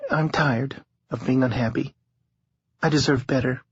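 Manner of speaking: a hint of a tired young woman. A narrator's reading. Harlow voiced in narration.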